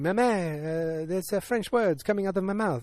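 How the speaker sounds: high, hammed-up French accent; mock French accent. [name] phones her mother, mock French accent. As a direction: fake French with a hesitant, put-on accent. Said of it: "She speaks in the French".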